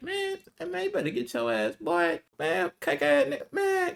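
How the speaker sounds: high, loud hype-man voice; squeaky homie pushing you to get up; homies hyping him up. Retold or imitated. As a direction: yell it at him like a hyped-up buddy. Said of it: "the homies talk anyway".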